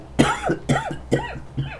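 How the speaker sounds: hoarse coughing after a screech; strained coughing fit. Real, not acted. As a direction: cough like your throat is raw.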